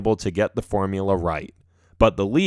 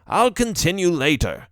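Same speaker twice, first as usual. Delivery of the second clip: exasperated professor; 6 semitones higher than usual. A narrator's reading. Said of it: "throwing his hands up in the air".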